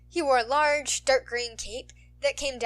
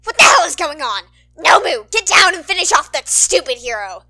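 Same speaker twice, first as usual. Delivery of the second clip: loud, higher, enraged yelling; furious screech; 5 semitones higher, 17 dB louder than usual. Acted. Hand villain screaming orders at Nomu, furious shout. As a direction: scream it like a tantrum.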